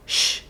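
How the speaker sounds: sustained hushing "sh" with no voice; voiceless "sh". Shown as a demonstration. Teacher contrasting sounds that vibrate or not, voiceless sh. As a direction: demonstrate a plain breath-only sh. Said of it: "Olha a diferença entre sh".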